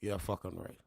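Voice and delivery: quiet, low disbelief; dismissive aside. Real, not acted. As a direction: drop it low and quiet, unconvinced. Scoffing aside at her claim.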